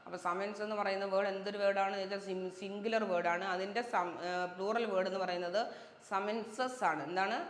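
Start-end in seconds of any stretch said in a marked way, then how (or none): none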